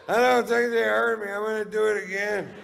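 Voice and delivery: very high, loud holler; acted drunken shouting. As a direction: holler it out to a far-off crowd. Drunk shouting over fleeing crowd.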